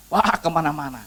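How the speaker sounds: ordinary talk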